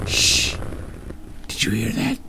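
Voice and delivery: urgent hushed whisper; whispered shout. A narrator's reading. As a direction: hiss a warning in the dark. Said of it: "said in a whispered shout".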